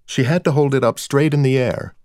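usual speaking voice

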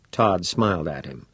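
usual speaking voice

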